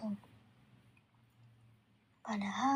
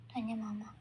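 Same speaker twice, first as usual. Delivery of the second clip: usual speaking voice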